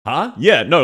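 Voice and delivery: normal talking voice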